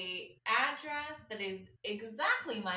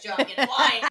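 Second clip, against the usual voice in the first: much louder, calling across the flat through a laugh; 3 semitones higher, 13 dB louder than usual. Retold or imitated. Calling to Joe in the next room, laughing shout.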